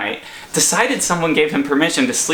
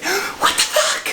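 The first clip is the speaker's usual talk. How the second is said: slow, breath-only stage whisper; slower than usual. Shown as a demonstration. Demonstrating the reaction to a burglar, exaggerated stage whisper. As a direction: whisper it slowly, as if shocked but not screaming. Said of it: "this sort of stage whisper".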